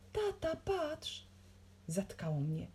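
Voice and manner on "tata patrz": high, drawn-out call; slow, high-pitched astonishment; amazed child. A narrator's reading. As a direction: draw Dad's attention in wonder. Child stunned by sight outside.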